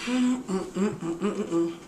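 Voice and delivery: mm-ing while chewing; chewing hum. Real, not acted. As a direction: hum with mouth full. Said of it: "you can hear me chewing".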